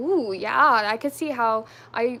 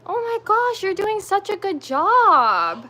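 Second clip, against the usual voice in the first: high, loud, slow proud-mom praise; gushing praise; 8 semitones higher, 7 dB louder, slower than usual. Real, not acted. Praising toddler finishing puzzle.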